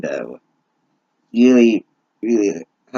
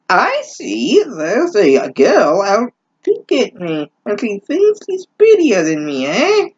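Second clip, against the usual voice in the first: high, sneering witch; mock the girl in a witchy sneer; high nasty hag, rising on eh; 10 semitones higher than usual. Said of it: "said the witch in a nasty voice".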